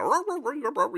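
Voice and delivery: high mock dog barks; light, high imitation of an excited dog barking.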